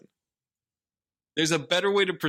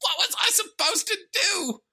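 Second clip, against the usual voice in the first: very high, squeaky mock protest; hammed-up squeaking impression of an upset son; Jaden; 4 dB louder than usual. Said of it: "Just let me do the impression".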